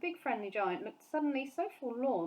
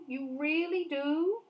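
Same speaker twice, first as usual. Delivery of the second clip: slow, eager delighted question; light up with hope; brightening up; 7 semitones higher, 4 dB louder, slower than usual. A narrator's reading. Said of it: "suddenly brightening".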